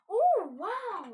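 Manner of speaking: surprised. Real, not acted. Surprised by favorite tool count.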